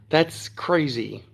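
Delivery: normal talking voice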